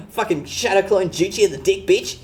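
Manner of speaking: hammed-up Aussie bogan threat; bogan Aussie accent. Streamer keeps the Aussie bogan act going, mock threat. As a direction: toss it off like a cocky Aussie weeb. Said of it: "the accent".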